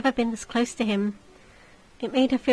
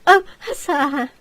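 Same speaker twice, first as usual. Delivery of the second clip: simpering lady; 4 semitones higher, 6 dB louder than usual. Acted. Lady fawns over the lord.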